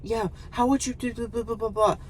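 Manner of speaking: high, soft prattle dissolving into babble; mock survey taker. Woman droning survey questions at a traveler, mocking high prattle. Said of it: "asking me questions about a survey".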